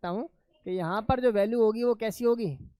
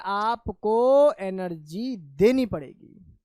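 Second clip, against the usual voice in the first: very slow and loud, stretching आपको and देनी; loud, drawn-out teaching emphasis; 2 semitones lower, 5 dB louder, much slower than usual. Real, not acted. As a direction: hammer the point home, word by stretched word. Teacher drives home the key point.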